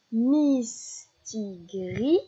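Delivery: "Mistigris" very slowly in three beats; syllable by syllable. Shown as a demonstration. Teacher helping a child spot the last syllable, "Mistigris" slowly split. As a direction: separate "Mistigris" into Mis... ti... gris. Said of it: "repérer ces dernières syllabes".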